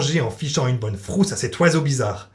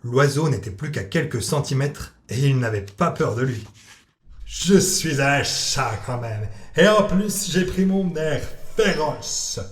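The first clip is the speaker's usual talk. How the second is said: Salem the cat; 3 semitones higher, slower than usual. Acted. Cat Salem eyeing the parrot, slow and sly. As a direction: savour each word like a sly cat spotting prey.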